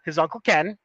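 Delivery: ordinary talk, nothing marked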